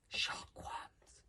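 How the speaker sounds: tiny, hushed, frightened voice; fearful gulp. Acted. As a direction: barely get it out in fear. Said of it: "gulped Fishlegs".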